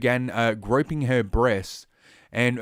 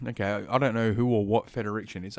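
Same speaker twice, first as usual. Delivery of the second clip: usual speaking voice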